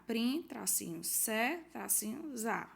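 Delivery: "Prim", "se", "za" isolados, com "tracinho" no meio; ditando sílabas. Shown as a demonstration. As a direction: dite "Prim, tracinho, se, tracinho, za" em pedaços. (sílabas de princesa ditadas uma a uma)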